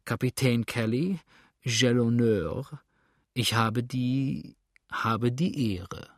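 put-on slow, slurred drunken greeting; slurring drunk. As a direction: drag the words like a drunk. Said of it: "sagte lallend". (lallend und langsam wie ein Betrunkener)

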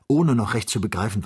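ordinary delivery, nothing marked

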